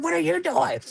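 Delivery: high, yelling back in confusion; mock yell back. Man yelling back at the officers, high confused shout. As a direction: throw the question straight back, high. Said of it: "I said".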